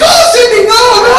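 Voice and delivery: high, loud mock panic; panicked cry. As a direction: shriek it like a horrified kid. Kids panic after shooting.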